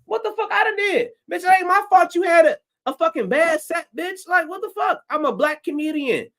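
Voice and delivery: silently ranting at the booker, high and indignant; indignant inner rant. Retold or imitated. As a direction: vent the outrage in a high, squeaky voice. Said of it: "I'm like".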